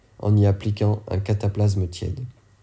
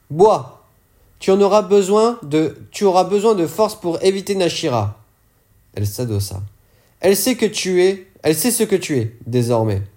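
Lys, higher and louder, urgent; higher, urgent voice of Lys warning her; character voice, higher; 8 semitones higher, 4 dB louder than usual. Acted. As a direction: press the drink on her with a higher, firm voice.

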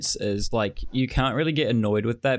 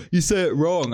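higher, louder imitation of critics; mock complaint; 7 semitones higher, 4 dB louder than usual. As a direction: imitate an outraged nitpicker. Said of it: "but then people go".

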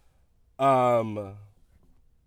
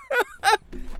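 high-pitched burst of laughter; amused laugh. Real, not acted. Storyteller remembering the confrontation, cracking up. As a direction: crack up at your own story.

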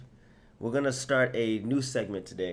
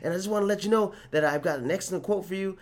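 high, loud, peppy salesman voice; mocking Tony's cheery pitch, high and loud; eager salesman; 10 semitones higher, 4 dB louder, faster than usual. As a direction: play an overeager sales rep pitching a quote. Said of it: "He was like".

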